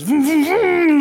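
high, loud revving vroom; engine noise. Retold or imitated. Car pulling up outside, voiced as a high loud engine roar. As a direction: rev it up like a roaring car. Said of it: "all he hears".

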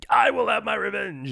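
high mock revenge growl; a hint of vengeful Kratos. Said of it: "he's just like".

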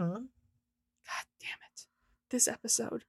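quiet, slow, exasperated groan; muttering groan. Real, not acted. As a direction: groan it under your breath. Groaning at the episode.

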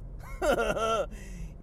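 goofy laugh. Real, not acted. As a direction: cackle at your own punchline. Signature laugh after a punchline.

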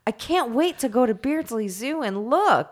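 high-pitched, gushing fan imitation; exaggerated amazement; mock gushing. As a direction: gush like an awestruck, gullible fan. Acts out woman gushing over pandas.

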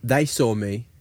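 usual speaking voice

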